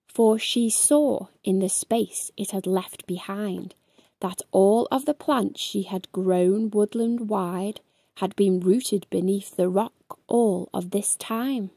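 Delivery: ordinary delivery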